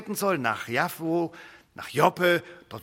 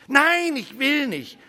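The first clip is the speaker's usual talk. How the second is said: stubborn refusal; 8 semitones higher, slower than usual. Acted. Peter refusing God's offer of food, slow stubborn refusal. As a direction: dig in and refuse.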